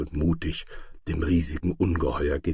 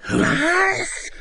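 very high, loud screeched hiss; shriek-hiss it like an outraged dragon; piercing monster outburst; 6 dB louder than usual. A narrator's reading. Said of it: "zischte der Drache".

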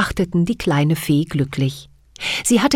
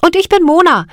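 put-on higher and louder eager girl; young girl's voice; 7 semitones higher, 7 dB louder than usual. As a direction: tell it proudly as a little girl. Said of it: "erzählte Mona".

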